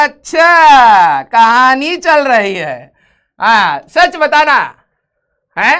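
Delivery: loud, slow teasing call to the class; playful shout. Real, not acted. Teacher teasing students about the story, slow playful shout. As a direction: drag it out loud to tease the students.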